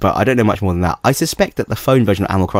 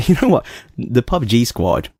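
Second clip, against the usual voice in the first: ordinary talk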